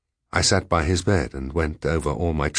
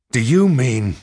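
higher, louder exclamation; shocked cry; 6 semitones higher, 6 dB louder than usual. A narrator's reading. Diarist answering Sadler's accusation, shocked cry. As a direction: cry out in disbelief. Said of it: "I cried".

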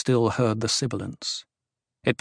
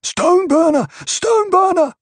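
screamed; 6 dB louder than usual. A narrator's reading. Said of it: "The cry was all around him".

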